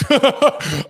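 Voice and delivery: high, loud laugh; real, sudden laugh. Real, not acted. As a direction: burst out laughing mid-story. Laughs mid-story.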